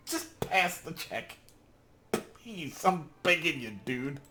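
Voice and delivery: high, slow begging; high-pitched plea to a dice roll; desperate pleading. Real, not acted. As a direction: beg the game to give you this.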